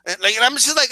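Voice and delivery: ordinary delivery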